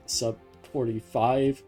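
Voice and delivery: ordinary talk, nothing marked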